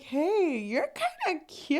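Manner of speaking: high, playful flirty pickup line; mock flirty hello; flirty come-on. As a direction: toss it off like a cheesy flirt. Flirty guy quote in retelling.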